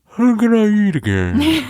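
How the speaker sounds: high, slow numb-mouth slur; numb-mouthed patient. Retold or imitated. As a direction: mangle the words with a numbed mouth. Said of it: "I'm trying to talk to her".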